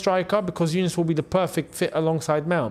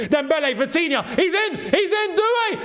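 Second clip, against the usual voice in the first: goal celebration shout; 12 semitones higher than usual. Real, not acted. Fan watching Doué score, ecstatic goal shout. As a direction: erupt as the goal goes in. PSG scores, streamer erupts live.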